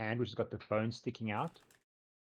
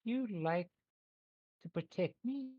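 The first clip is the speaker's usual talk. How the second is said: high, halting little creature voice; small creature asking shyly for protection; pleading creature; 10 semitones higher, slower than usual. Acted. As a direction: plead gently, pitch climbing high. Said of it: "and the creature goes".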